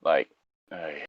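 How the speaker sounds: usual speaking voice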